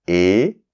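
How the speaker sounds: et said as a tight closed é; et pronounced like the é of été. Shown as a demonstration. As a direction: say et as é, set apart by pauses. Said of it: "il y a une différence quand même entre".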